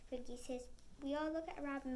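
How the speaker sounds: plain reading voice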